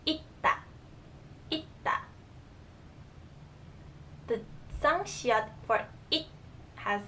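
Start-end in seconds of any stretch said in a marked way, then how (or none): 0.1-2.0 "itta" with "it" stopped on a T before "ta"; careful "itta", held T in the middle; final T sound. Shown as a demonstration. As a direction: model "itta" in two careful syllables, T closing the first. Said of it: "the T sound".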